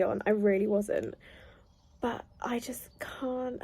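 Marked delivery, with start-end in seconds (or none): 2.0-3.6 soft breathless whisper. Real, not acted. Overcome reader whispering to viewers, softly. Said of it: "unvoiced whisper".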